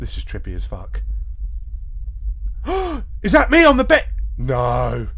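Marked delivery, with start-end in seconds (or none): 1.1-3.2 shocked gasp. Real, not acted. Sees himself on the bed.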